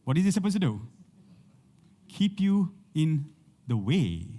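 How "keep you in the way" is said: slow emphasis. Acted. Preacher answering his own question.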